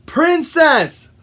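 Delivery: Princess called out high and loud; shouted correction. Acted. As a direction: shout "Princess" as one word to correct them. Frog shouts correction to woodpecker.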